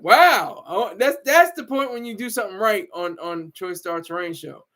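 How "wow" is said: a loud, drawn-out mock "Wow"; imitating the host. Imitating the show host reacting when someone does it right, a mock loud "Wow". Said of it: "he's like".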